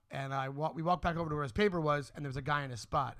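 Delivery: ordinary delivery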